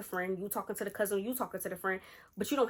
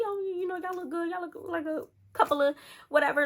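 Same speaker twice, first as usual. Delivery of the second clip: high, put-on sweet-talk; mock flattery; 10 semitones higher, 3 dB louder than usual. Imagined men flatter the women at the bar, mock flattery. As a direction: imitate men laying on compliments, pitched way up.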